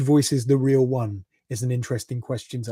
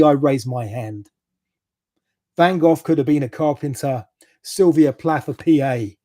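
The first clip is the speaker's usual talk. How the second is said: normal talking voice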